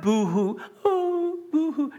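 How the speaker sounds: high, drawn-out fake boo-hoo; mock boo-hoo crying. Shown as a demonstration. Preacher showing the congregation the wrong kind of crying, mock boo-hoo. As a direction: mimic weepy crying, pitched well up. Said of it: "No, cried, proclaimed".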